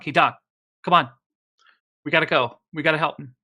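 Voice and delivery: rally the doctor to come and help; urgent call. Acted. Acted calling the doctor to help.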